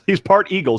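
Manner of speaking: ordinary talk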